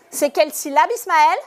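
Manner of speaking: usual speaking voice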